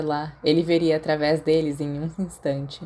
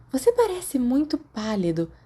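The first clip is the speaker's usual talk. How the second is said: put-on curious girl; 7 semitones higher than usual. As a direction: ask it with curious concern. Tina voiced by narrator.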